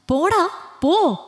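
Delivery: high drawn-out furious scream. Acted. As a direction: scream him away, slow and high. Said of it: "അവൾ അലറി".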